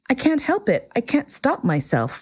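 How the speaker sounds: little prince caught out and apologetic; embarrassed. A narrator's reading. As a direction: excuse yourself, a touch embarrassed. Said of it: "thoroughly embarrassed".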